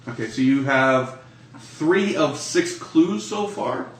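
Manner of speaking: ordinary talk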